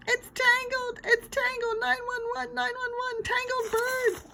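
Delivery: very high screaming holler. A narrator's reading. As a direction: holler in full panic. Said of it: "I hollered".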